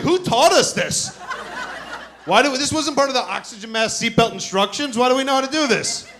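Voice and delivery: high, loud, laughing disbelief; baffled disbelief. Retold or imitated. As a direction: ask it up high, amazed and amused. Baffled at bracing chant.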